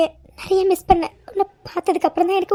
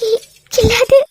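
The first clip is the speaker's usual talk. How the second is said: tearful stammer; 3 semitones higher, 4 dB louder, slower than usual. Acted. Wife on verge of tears.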